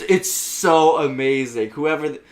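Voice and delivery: ordinary talk, nothing marked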